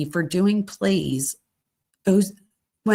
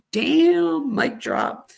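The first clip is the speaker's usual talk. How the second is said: a high, sudden "damn" of delight; impressed exclaim; 8 semitones higher, much slower than usual. Real, not acted. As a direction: react like you just saw a mic drop. Reacting to his comeback in text.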